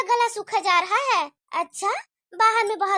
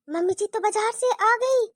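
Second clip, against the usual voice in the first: normal talking voice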